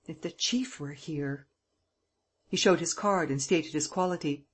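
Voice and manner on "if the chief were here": soft, slow mutter to himself; perplexed slow mutter. A narrator's reading. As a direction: mumble it under your breath, slowly. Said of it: "he muttered".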